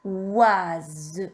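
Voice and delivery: "Oise" drawn out, z released clearly at the end; syllable dictation. Shown as a demonstration. Teacher repeating the syllable for pupils, slow and drawn out. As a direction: stretch "Oise" through to a sounded final z. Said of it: "Troisième syllabe".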